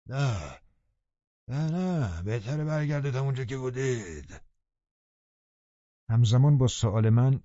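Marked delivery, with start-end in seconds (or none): 0.1-4.4 a nasal, whining little refusal. A narrator's reading. Old servant answering from inside, nasal and whiny. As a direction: pinch it through the nose, a touch tearful. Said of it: "بعد تو دماغی اضافه کرد".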